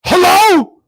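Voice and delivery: angry hello. Retold or imitated. Answering a call during his show, a loud irritated hello. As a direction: bark the greeting at the interrupting caller. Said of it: "I'm mad".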